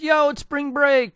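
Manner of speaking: high, chirpy mock invitation; light mock party-girl call; excited character voice. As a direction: toss it out high and eager. Voicing character's phone call.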